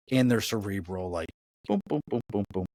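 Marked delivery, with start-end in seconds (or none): none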